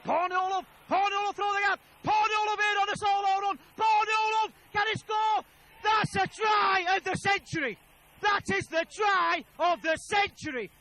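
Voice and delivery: rising into a full shout as the try comes; excited shout. Real, not acted. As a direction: roar the try call, climbing. Commentator erupts at try.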